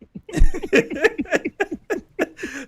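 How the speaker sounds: big hearty laugh; bursting out laughing; loud laughter. Real, not acted. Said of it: "I can't stop laughing".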